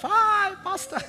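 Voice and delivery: high, gushing "Hi, pastor"; eager greeting. Retold or imitated. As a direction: fawn over the pastor, voice jumping up. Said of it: "throwing yourself".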